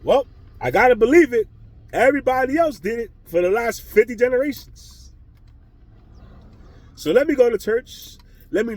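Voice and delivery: high, loud sarcastic believer voice; mock believer. Mocking the churchgoers, a loud sarcastic believer voice. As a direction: ham up the dutiful churchgoer.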